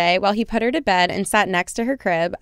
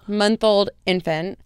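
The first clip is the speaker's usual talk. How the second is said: usual speaking voice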